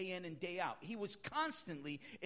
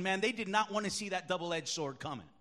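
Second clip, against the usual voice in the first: ordinary talk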